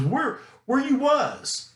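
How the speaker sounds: high, drawn-out Louisiana imitation; imitated Louisiana talk. Teacher imitates Louisiana locals' bad grammar, high drawled question. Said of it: "things they always say".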